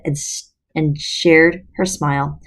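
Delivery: ordinary delivery, nothing marked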